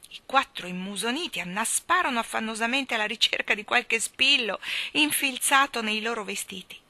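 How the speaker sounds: ordinary delivery, nothing marked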